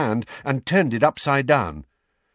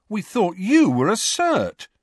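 high-pitched accusation; accusing; 11 semitones higher, 4 dB louder than usual. A narrator's reading. Fred turning on Harry as if tricked, accusing. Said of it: "in a tone that suggested Harry had tricked them".

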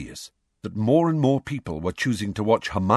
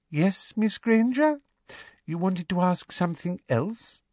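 syrupy fake patience; fake sweetness; 12 semitones higher than usual. Umbridge feigned patience.

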